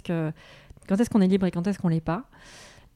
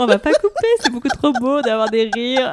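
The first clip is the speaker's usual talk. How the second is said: talking through high laughter; laughing; 5 semitones higher, 8 dB louder than usual. Real, not acted. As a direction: giggle out the words. Both laughing at the ending.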